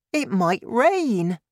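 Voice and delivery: put-on high, slow, worried child; high-pitched boy's concern; young boy's voice. A narrator's reading. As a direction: draw it out as a pleading boy. Boy pleads for grandma.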